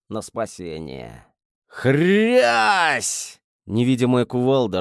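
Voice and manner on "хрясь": crunching impact sound; loud crash imitation. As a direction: hit the smash like a blow. Sound of crushing blow.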